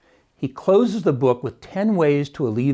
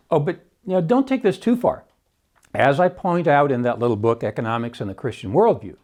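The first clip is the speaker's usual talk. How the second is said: usual speaking voice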